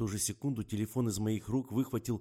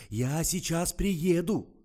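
much higher, louder, slow alarmed cry; frantic; 10 semitones higher, 10 dB louder, much slower than usual. Acted. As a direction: panic and insist you are coming. Publicist frantic on phone.

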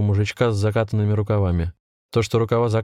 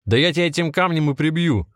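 higher-pitched, restrained rage; angry threat; 7 semitones higher than usual. A narrator's reading. Volodya threatens the old man, mildly furious. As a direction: lash out with raised pitch.

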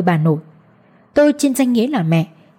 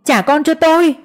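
shout; 7 semitones higher than usual. Acted. Mother screams at her in-laws to give back her child, anguished shout. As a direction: scream it out in pain. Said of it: "Tôi đau đớn nhìn họ hét lên".

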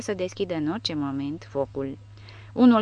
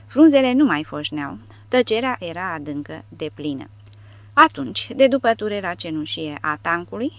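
ordinary delivery

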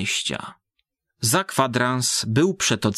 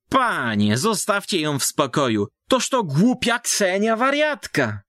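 put-on higher old peasant woman's voice; village woman warning; 8 semitones higher than usual. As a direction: warn him off like a country gossip. Village woman warns the man. (wysoki głos baby, ostrzegający)

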